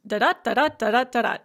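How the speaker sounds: loud "da-da" with each second da punched; iambic rhythm. Shown as a demonstration. Teacher demonstrates unstressed then stressed, chanted "da-DA" rhythm. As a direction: beat out "da-da" as weak-STRONG, four times over. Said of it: "So unstressed, stress, unstressed, stress".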